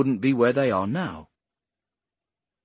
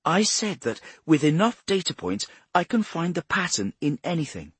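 high-pitched, insistent explainer; earnest Bradshaw; 8 semitones higher than usual. Acted. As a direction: pitch it up and press the point.

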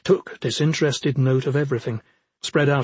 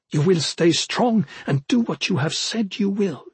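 higher-pitched, insistent character voice; insistent Arjun; 5 semitones higher than usual. Acted. Woman demanding proof of loyalty from her companion, insistent. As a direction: press the demand in a raised pitch.

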